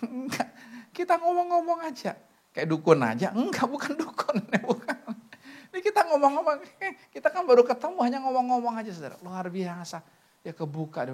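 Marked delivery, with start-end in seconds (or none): 3.3-5.0 laughing it off, high and light; laughing denial. Real, not acted. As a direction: chuckle through the denial. Laughing off being called shaman.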